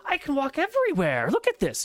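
put-on like a child seeing the world anew; wondering Euclid. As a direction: awestruck young man.

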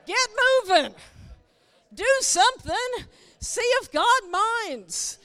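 a loud, rousing charge to the congregation; loud urging. Real, not acted. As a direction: preach it, rousing the crowd.

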